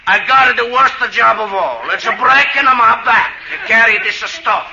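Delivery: put-on broad comic Italian accent, laughing through it. Italian Santa grumbling to the convention in a comic Italian accent. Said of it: "He got up and he said".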